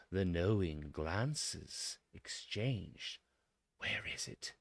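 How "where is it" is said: whispered, soft and breathless question; silent wondering whisper. Retold or imitated. Listeners wondering where the song is, in a whisper. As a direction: breathe it out like a secret worry. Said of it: "they wonder silently".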